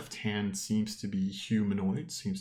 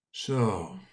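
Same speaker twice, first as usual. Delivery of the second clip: deep rumbling voice. Acted. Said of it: "you hear a deep rumbling voice".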